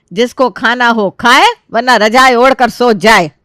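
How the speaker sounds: loud scolding mother silencing the house; furious, forceful telling-off, slowing at the end; angry shouting. Acted. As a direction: shout them all down. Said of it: "Kiran जी बहुत जोर से चिल्लाती है".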